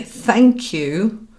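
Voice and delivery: high, emphatic thank you; empowering gratitude. Shown as a demonstration. Coach offers the wording to replace please, empowering gratitude. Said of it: "that's a very different energy. It's empowering".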